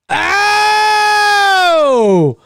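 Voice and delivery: long, high-pitched wailing cry; long howl. Acted. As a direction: let out a drawn-out high howl. Reacting to the movie on screen.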